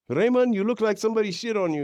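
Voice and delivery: high, mock German mother accent. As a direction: imitate his German mom teasing. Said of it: "in her German accent".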